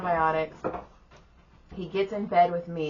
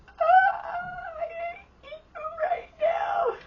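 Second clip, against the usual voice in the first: very high, drawn-out squeal; slow, squeaky wail of mock hatred; mock anguished scream; slower than usual. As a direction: scream it like a tantrum, hammed up. Acts out her overwhelmed reaction.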